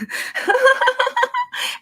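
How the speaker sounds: very high, airy put-on laugh; a soaring diva giggle; new laugh. Trying out a new laugh for her viewers, very high. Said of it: "that was gonna be my new laugh".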